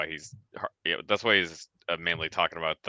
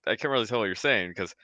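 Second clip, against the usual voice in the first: usual speaking voice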